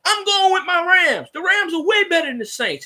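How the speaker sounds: high put-on voice mocking a pundit; exaggerated high mock of Ryan Clark; mocking imitation. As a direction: sneer out his Rams loyalty. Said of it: "the thing goes".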